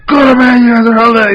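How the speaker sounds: a father bellowing, woken in the middle of the night; dad yelling. Retold or imitated. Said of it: "He just started yelling at them".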